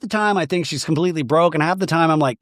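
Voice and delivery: ordinary talk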